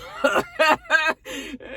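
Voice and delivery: a high burst of laughter. Real, not acted. Vlogger laughing at the show.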